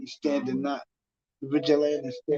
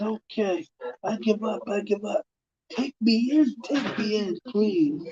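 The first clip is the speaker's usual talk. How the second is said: higher, begging please; pleading surrender; 6 semitones higher than usual. Retold or imitated. Frightened man begging to be taken in: higher, pleading. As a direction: plead to be arrested, scared. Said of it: "He says".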